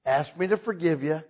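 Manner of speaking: usual speaking voice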